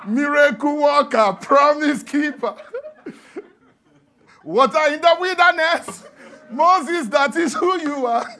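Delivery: loud, high and slow praise chant; mock crowd cheering Moses; crowd shouting praise. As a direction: hail him like a cheering crowd. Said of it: "people just suddenly shouting".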